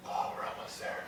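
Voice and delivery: strained whisper mid-squat. Real, not acted. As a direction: whisper through the effort. Straining in iso squat hold.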